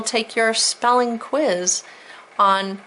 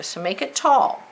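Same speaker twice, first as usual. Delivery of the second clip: normal talking voice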